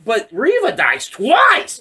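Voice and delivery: high, slow, emphatic disbelief; incredulous protest. Retold or imitated. Fan arguing Reva should be dead, incredulous protest. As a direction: stretch the words in baffled complaint.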